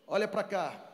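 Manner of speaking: ordinary talk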